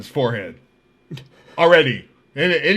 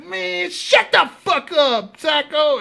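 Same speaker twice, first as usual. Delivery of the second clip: high, slow imitated heckle; mock irritated comeback; 11 semitones higher, slower than usual. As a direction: yell back at the ranter, pitched up and dragged. Inmates yell back at him.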